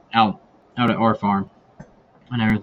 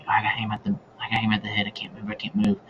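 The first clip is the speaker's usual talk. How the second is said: softer, held-breath murmur; hunter's thoughts while aiming at a turkey, softer; hushed inner thought; 8 dB quieter, faster than usual. Retold or imitated. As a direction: think it to yourself, frozen still.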